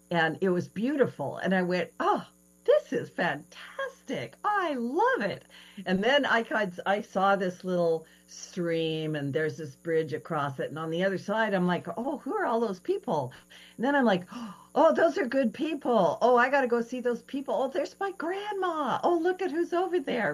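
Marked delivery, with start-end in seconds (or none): 2.0-5.4 slow, high, delighted gasping; blissful wonder. Retold or imitated. Retelling delight on the other side. 12.0-13.3 high, eager wondering; lightly curious rise; curious excitement. Retold or imitated. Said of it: "And so I'm all excited". 14.7-20.3 high, loud and eager; bubbling over at seeing grandma; giddy excitement. Retold or imitated. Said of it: "And so I'm all excited".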